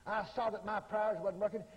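normal talking voice

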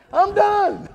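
laughing declaration. Retold or imitated. Retold leaving, laughing.

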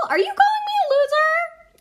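high, incredulous squeak; hammed-up indignation; mock offended. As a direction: squeal the question in playful outrage. Pretend offence at being called L.

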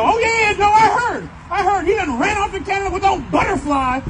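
high, loud, hammed-up know-it-all; mock clueless voice. Young him pretending he heard, hammed-up high voice. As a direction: play the eager fool, loud and high.

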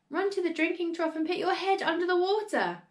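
high, urgent instruction; frantic shriek. A narrator's reading. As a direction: urge her to save herself. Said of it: "shrieked Missus Cranky".

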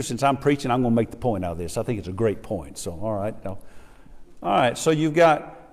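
ordinary talk, nothing marked

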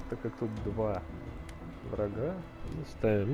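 usual speaking voice

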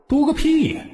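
put-on a boy exploding in protest; indignant outburst. Boy emperor rants at ministers.

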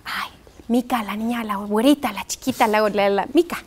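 imitating teacher.

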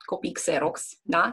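normal talking voice

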